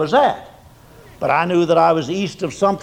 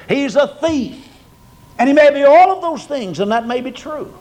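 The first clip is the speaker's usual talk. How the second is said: fervent preaching; 6 semitones higher than usual. Acted. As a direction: preach with mounting conviction. Preaching at full force.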